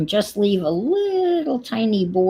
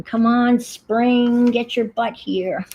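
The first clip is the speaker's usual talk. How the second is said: pleading with spring; 6 semitones higher, slower than usual. Retold or imitated. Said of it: "you're saying".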